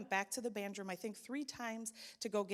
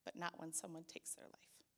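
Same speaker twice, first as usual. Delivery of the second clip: hushed whisper; 3 semitones lower, 11 dB quieter than usual. Real, not acted. Painful truth about suicide.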